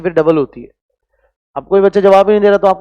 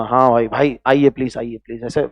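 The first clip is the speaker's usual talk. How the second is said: mock respectful welcome; 6 semitones lower, 4 dB quieter than usual. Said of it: "थोड़ी बहुत इज्जत आपको दूंगा".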